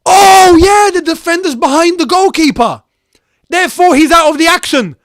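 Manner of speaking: high, loud burst of jubilant realisation; real excited yell, high-pitched; elated shouting. Real, not acted. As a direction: shout it out in relief and glee. Jubilant on realising goal is offside.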